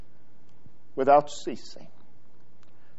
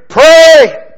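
a loud, high one-word shout; shouted command; 11 semitones higher, 11 dB louder than usual. Acted. Preacher urging listeners in battle to pray, shouted. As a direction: bark the word at the congregation.

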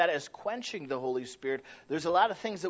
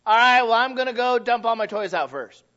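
put-on raised, higher kid's excuse; mock childish stalling; 5 semitones higher, 7 dB louder than usual. Child answers the father, higher and raised.

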